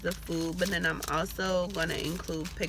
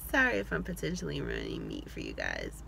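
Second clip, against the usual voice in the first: deadpan low apology; 4 dB quieter than usual. Pretend. Mock apology repeated low.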